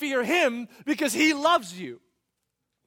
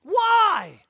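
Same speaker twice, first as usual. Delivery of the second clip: emphatic why; 7 semitones higher, 10 dB louder than usual. Real, not acted. Repeated rhetorical question, raised.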